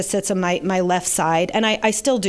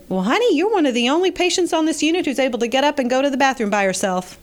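put-on Southern accent; 6 semitones higher, faster than usual. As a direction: put on a chatty, high-pitched Southern nurse voice. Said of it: "she said in her Southern accent".

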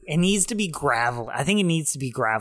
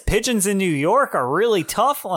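eighties stand-up comedian; 5 semitones higher, 4 dB louder than usual. Retold or imitated. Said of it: "an eighties stand-up comedian".

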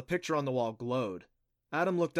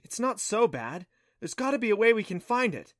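raised, insistent encouragement; reasoning; 9 semitones higher, 6 dB louder than usual. A narrator's reading. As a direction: talk a discouraged friend round, with lift. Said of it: "Clancy reasoned".

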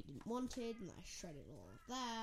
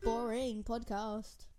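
alien voice; 2 semitones higher, 7 dB louder, slower than usual. Pretend. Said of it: "and then you go".

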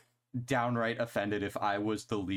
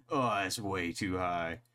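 mocking co-host impression; 3 semitones lower than usual. Teasing the co-host over his band rankings, mocking impression. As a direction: mimic your co-host complaining. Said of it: "That's what you sound like right now".

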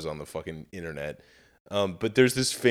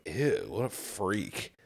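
slow, softer mock disgust; disgusted girl; 8 dB quieter, slower than usual. Mocking a girl on the train, slow mock disgust. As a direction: draw out the ew with a sneer. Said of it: "looking at him going".

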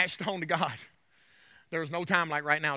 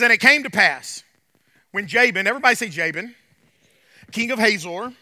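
scripture reading; 7 dB louder than usual. Acted. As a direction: proclaim the verses to the congregation. Reads scripture aloud.

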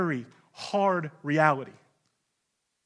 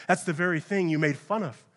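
ordinary delivery

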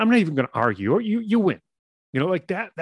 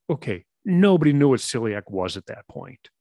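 ordinary delivery

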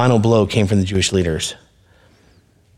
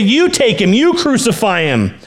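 exasperated Pilate; 11 semitones higher, 3 dB louder than usual. Acted.

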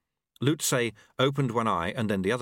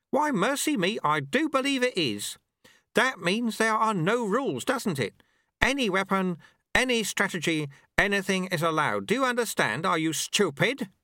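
higher, mock-surprised old man; mock innocent old master; 10 semitones higher than usual. A narrator's reading. Master goads his apprentice, mock innocent. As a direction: feign wide-eyed innocence, then needle.

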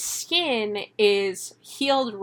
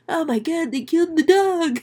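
high-pitched mock horror; hammed-up squeaky dismay; appalled puppy; 7 semitones higher than usual. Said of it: "She was like".

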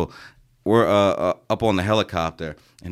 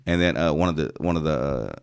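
ordinary delivery

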